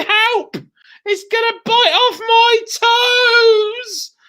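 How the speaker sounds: high, loud panicked shriek; screaming. Acted. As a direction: scream in terror. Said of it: "screaming and shouting".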